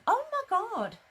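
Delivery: high, loud shocked gasp. Real, not acted. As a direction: react in disbelief. Shocked at Facebook reprimand.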